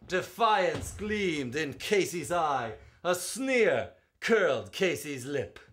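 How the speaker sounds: rising and falling ballad read, full of drama; spirited verse reading; dramatic poem recitation. Acted. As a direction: recite it like a storyteller at the ballpark.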